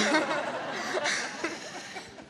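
light embarrassed laugh; shy little laugh; nervous giggle. Real, not acted. After asking for internship.

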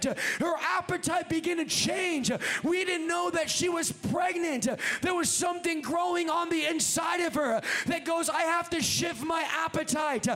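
loud, driving sermon cadence; full-force preaching. Real, not acted. Preacher urging congregation to carry God's vision, full-force preaching. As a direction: preach it hard, stretching the phrase endings.

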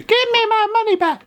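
very high mock wail; panicked bank customer. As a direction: squeal it like a hammed-up bank-run customer. Acting out a bank run.

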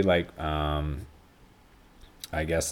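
ordinary talk, nothing marked

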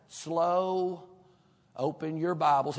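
put-on very drawn-out North Carolina mountain accent; mountain drawl. Sunday school teacher to his class, slow mountain drawl. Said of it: "he talks just like this".

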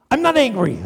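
mock-angry comeback, played for a laugh; angry denial. Angry person snapping back in denial.

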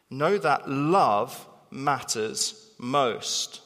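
slow, weighty statement; very slow emphasis. Shown as a demonstration. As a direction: lay each word down very slowly. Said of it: "repeated theme, very slow".

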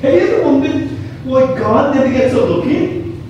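ordinary talk, nothing marked

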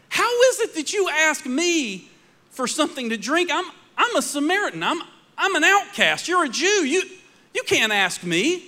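surprised outcast woman. Acted. Samaritan woman objects to Jesus.

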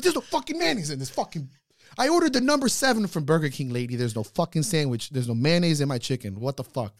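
high, loud furious yelling; angry rant. Retold or imitated. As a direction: scream it at the fast-food counter. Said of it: "Anger is my love language".

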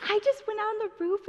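high, quick mock kid voice; Gabe. Little brother telling mom about the roof, exaggerated high voice. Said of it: "he doesn't talk like this".

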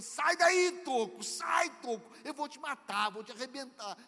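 screaming high and loud in fury; berserk rage. Retold or imitated. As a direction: lose it completely at a stump. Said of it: "o cara ficou desesperado".

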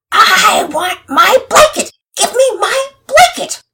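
slow, piercingly high tantrum scream; infuriated scream. A narrator's reading. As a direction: scream each word as a furious child. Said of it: "he began to scream".

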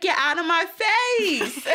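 high, slow mock shout; mock yell. Lady yelling at the newscaster, slow high mock shout. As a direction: drag the words out in a high yell. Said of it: "she was like".

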